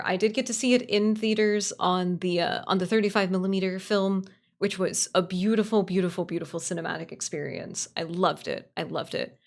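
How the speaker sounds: normal talking voice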